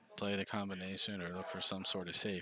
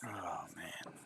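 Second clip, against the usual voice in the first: whispered; 6 dB quieter than usual. Real, not acted. Quiet sigh during slow match.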